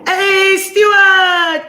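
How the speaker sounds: loud high call across the room; yelled greeting. Shown as a demonstration. Yelling good morning at the co-host's cue. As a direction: yell the greeting out with gusto. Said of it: "Yell it".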